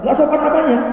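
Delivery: melengking tinggi dan keras, membantah; balasan ketus. Retold or imitated. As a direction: bantah dengan nada tinggi tersinggung. Istri membalas dengan ketus. (istri membantah suami, tinggi dan ketus)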